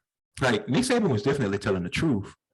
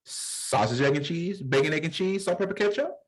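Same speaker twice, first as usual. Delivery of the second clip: rising, higher deli order call-out; deli counter call; 6 semitones higher than usual. Retold or imitated. Deli cashier calling out orders, lightly mimicked. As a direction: call the orders like a register clerk. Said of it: "Whoever is at the register says".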